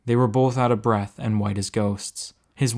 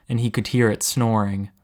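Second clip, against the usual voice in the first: usual speaking voice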